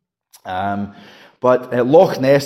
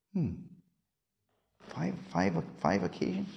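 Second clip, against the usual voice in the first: low, very soft thinking aloud; puzzled musing; 6 semitones lower, 16 dB quieter than usual. Retold or imitated. Preacher recalling his confusion at the phrase, puzzled musing. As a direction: murmur it to yourself, halting and questioning.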